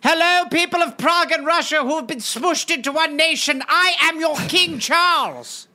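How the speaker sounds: mock royal address. Young Charles greeting his new nation, mock royal proclamation. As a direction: announce it as a silly English king.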